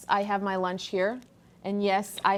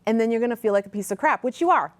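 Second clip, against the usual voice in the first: ordinary talk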